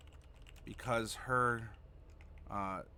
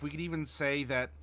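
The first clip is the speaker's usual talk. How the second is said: ordinary talk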